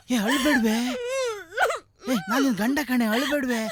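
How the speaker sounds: anxious coaxing. Acted. Husband coaxing crying wife. (ಅಳುತ್ತಿರುವ ಹೆಂಡತಿಯನ್ನು ಏರು ದನಿಯಲ್ಲಿ ಸಮಾಧಾನಿಸುವುದು)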